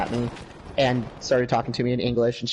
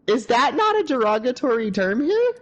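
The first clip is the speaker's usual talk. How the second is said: pointed question; 10 semitones higher than usual. Retold or imitated. Confronting manager.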